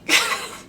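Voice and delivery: high breathy wheeze of laughter; real laugh. Real, not acted. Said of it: "I'm crying".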